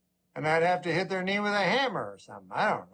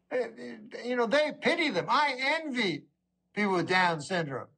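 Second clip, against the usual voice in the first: normal talking voice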